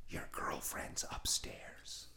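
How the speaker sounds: hushed whisper of a pissed roommate. Retold or imitated. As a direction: breathe it out, barely voiced. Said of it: "So he's whispering".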